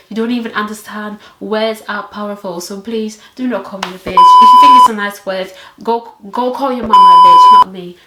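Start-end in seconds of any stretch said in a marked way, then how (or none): 2.6-8.0 louder, fast scolding; indignant. Real, not acted. Scolding viewers who call her that word, louder. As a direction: tell them off hard. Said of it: "scolding viewers".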